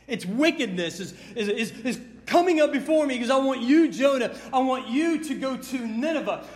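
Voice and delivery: loud, driving sermon; fired-up preacher voice; full-force preaching. Acted. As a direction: preach it hard to the congregation.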